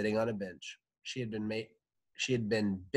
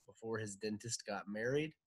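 ordinary delivery, nothing marked

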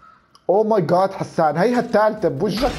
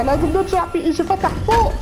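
urgent; 10 semitones higher than usual. Real, not acted. Urgent call to go back.

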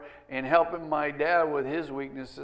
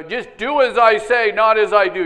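loud, higher-pitched imitation of a preachy dad; mock dad lecture; 5 semitones higher, 11 dB louder than usual. Dad laying down rules for kids, mock lecture. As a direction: lay down the rule like a dad, mockingly. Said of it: "You ever heard a dad say that?".

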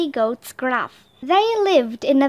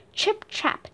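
light hoof taps; 8 semitones higher, 6 dB quieter than usual. A narrator's reading. Hoof sound effect.

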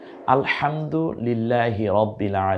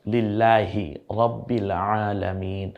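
usual speaking voice

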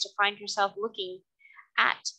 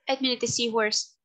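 usual speaking voice

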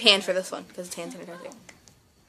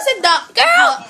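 exasperated; 13 semitones higher, 6 dB louder, much slower than usual. Real, not acted. Exasperated at partner.

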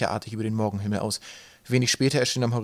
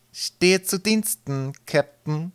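slow, higher-pitched servile reply; dutiful mate; 8 semitones higher, 4 dB louder, much slower than usual. A narrator's reading. Mate reporting to his angry captain, slow and dutiful. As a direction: draw it out, high and obliging.

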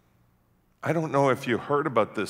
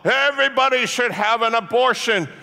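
high, very loud protester's scream; shouted at full volume, pitched way up; 11 semitones higher, 9 dB louder than usual. Retold or imitated. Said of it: "who are screaming".

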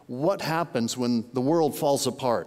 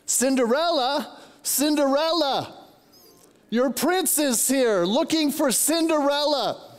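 high, sing-out fairy-tale prince call; playful calling out; 9 semitones higher than usual. Retold or imitated. Grandpa announcing the prince to his granddaughter upstairs, playful high calling.

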